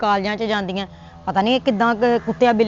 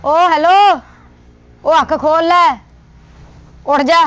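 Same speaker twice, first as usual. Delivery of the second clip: calling out; 3 semitones higher, 5 dB louder than usual. Acted. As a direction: shout at him to wake up. Wife calls to wake husband. (ਪਤਨੀ ਪਤੀ ਨੂੰ ਜਗਾਉਂਦੀ, ਉੱਚੀ ਆਵਾਜ਼ ਵਿੱਚ ਹਾਕ)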